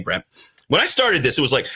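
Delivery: ordinary talk, nothing marked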